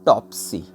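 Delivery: "टॉपसी" with the push on टॉप, सी let fall; stress on first syllable. Shown as a demonstration. Teacher showing students a trochaic foot, stress on टॉप. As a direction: lean on टॉप in "टॉपसी", drop off on सी. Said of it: "पहला जो sound उस पे जोर देना है".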